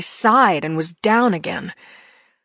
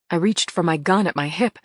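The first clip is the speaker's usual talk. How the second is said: ordinary delivery, nothing marked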